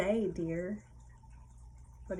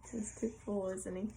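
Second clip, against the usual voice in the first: ordinary delivery, nothing marked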